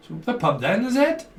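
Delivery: mock Welsh accent, pitch leaping up on the tag question; a bad Welsh accent, climbing high on "is it". Tarot reader trying a Welsh pub line, mock Welsh accent. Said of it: "that's not a Welsh accent".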